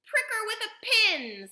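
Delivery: high, loud shout; called out loudly. A narrator's reading. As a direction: shout the suggestion over the panic. Another father calls out advice.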